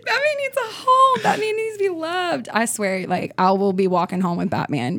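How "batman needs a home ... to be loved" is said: high, laughing near-sob plea; laughing whine. Real, not acted. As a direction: beg through tearful laughter. Pleading for dog adoption, laughing.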